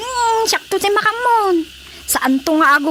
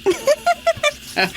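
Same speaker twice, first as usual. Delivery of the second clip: mocking laugh; 10 semitones higher, much slower than usual. Mocking echo then laugh.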